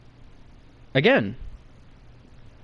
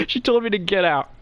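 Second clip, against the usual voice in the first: higher voice, fresh from a laugh; laughing through words; 4 semitones higher than usual. Real, not acted. Storyteller repeating his own humiliation, laughing. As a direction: repeat it while still cracking up. Repeats punchline just after cracking up.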